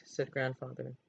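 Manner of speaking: ordinary delivery, nothing marked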